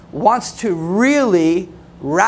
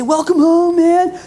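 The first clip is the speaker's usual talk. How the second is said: much higher, gushing welcome; cheery church greeter; 9 semitones higher, 3 dB louder than usual. Retold or imitated. Greeter welcoming the coach to a happy church, high and gushing. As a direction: ham up the overeager welcomer. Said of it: "And they say".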